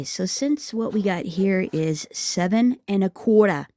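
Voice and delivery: ordinary talk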